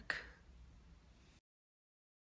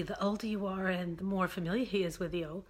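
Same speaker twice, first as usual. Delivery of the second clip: usual speaking voice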